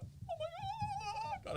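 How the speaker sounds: very high, drawn-out squeal; mock excited squeal. Shown as a demonstration. Demonstrating the excited dance to listener, mock excited squeal. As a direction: stretch it out in a hammed-up excited shriek. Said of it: "The excited dance, like".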